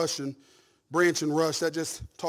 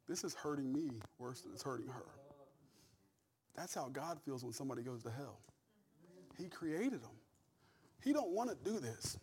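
choked up; 13 dB quieter than usual. Real, not acted. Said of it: "I'm welling up thinking about it".

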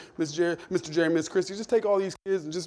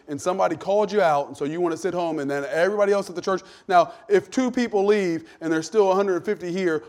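ordinary talk, nothing marked

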